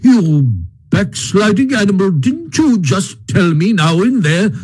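high, appalled scolding of a friend; shocked rebuke, voice lifted high; scandalized. A narrator's reading.

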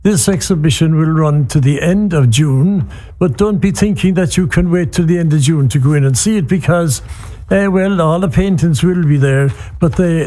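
normal talking voice

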